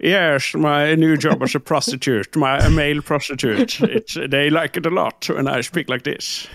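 put-on slurred Scottish accent with sh for s; Sean Connery. Joking about his new job in English, Scottish Connery accent. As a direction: do a hammy Scottish film-star accent. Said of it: "etterligner han Sean Connery, faktisk".

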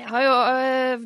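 ordinary talk

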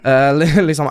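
usual speaking voice

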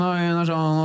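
ordinary delivery

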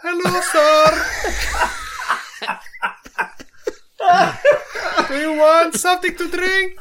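very high, laughing waiter voice. Retold or imitated. Imitating the sushi waiter with a high voice for friends. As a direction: squeak it out like a giddy waiter. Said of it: "Lys stemme".

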